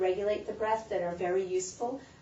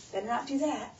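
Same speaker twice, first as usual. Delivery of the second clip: ordinary talk, nothing marked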